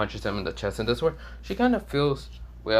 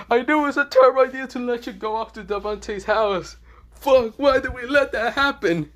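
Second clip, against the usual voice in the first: much higher, louder furious yell; angry parents; 13 semitones higher, 7 dB louder than usual. Acted. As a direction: shout at the son in outrage. Parents yelling about the uncle.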